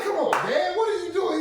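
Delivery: high, loud mock pleading; retold exasperated protest. Friend protests to dad caught at computer, exasperated high whine.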